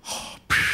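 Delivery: put-on child copying a masked villain's breath; mimicked villain breathing. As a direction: puff out a mechanical breath. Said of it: "looks over and she goes".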